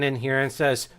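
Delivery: ordinary delivery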